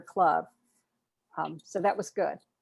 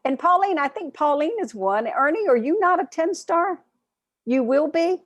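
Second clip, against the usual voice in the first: pitched well higher and louder, playful; excited calling out; 9 semitones higher, 5 dB louder than usual. Real, not acted. Host teasing members on Zoom about being ten stars: excited, higher, louder. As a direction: call out names like a cheerful host.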